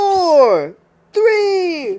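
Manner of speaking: high, ringing countdown call; shouted countdown. Real, not acted. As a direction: call out each number like an announcer. Host shouts countdown over co-host.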